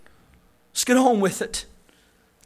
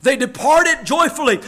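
ordinary talk, nothing marked

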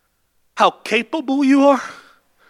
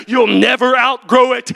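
usual speaking voice

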